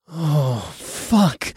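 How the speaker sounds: rising groan of pleasure. Acted. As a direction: let it out as a groan. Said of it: "I groaned".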